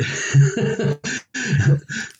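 high chuckling laugh; amused, trailing laughter; real laugh. Real, not acted. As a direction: laugh at your own bad luck. Laughs after ending his story.

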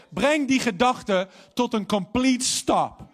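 preaching. Acted. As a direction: hammer each word home slowly. Preek over zorgen stoppen. (langzaam en nadrukkelijk preken)

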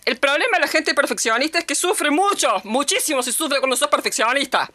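words churned round the mouth like cement; mushy mouthed lady. Retold or imitated. Shop lady lecturing on perfectionism, words churned in the mouth. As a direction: talk as if chewing cement. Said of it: "como revolviendo dentro de la boca".

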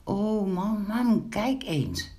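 put-on mildly alarmed child calling mum; startled cry. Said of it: "dat het meisje verschrikt riep".

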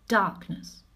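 ordinary delivery

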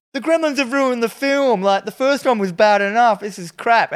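high, indignant mock rant; worked-up retold grievance, pitched high; acted complainer. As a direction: imitate an outraged moviegoer complaining. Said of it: "someone comes out and just goes".

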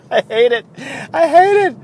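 high, loud squeal through laughter; laughing exasperation. Real, not acted. Host can't stand people saying brah, laughing exasperation. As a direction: crack up while protesting.

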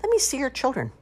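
put-on high-pitched old duck voice; high character voice. A narrator's reading. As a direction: demand a look, pitched high. Old duck inspects the children.

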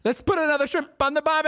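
high, fast cartoon Australian accent; hammed-up Australian accent. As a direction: shout it in a silly Aussie twang. Everyone trying Australian accents.

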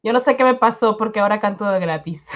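usual speaking voice

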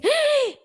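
high, loud startled "ah"; alarmed gasp. Acted. Doctor seeing a patient's glucose reading, alarmed cry.

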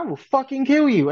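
imitating a furious mother; mock enraged threat. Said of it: "She's like".

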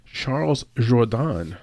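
slow, careful French-accented name. Shown as a demonstration. Host trying a fighter's name his best, French accent. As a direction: attempt a French pronunciation. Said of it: "my best attempt at saying Jourdain in a French accent".

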